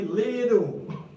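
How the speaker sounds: LITTLE stretched loud and high as liddle; loud, slow liddle for little; drawn-out little, no T. Shown as a demonstration. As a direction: draw out little slowly with a D for the T. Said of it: "you don't hear the T".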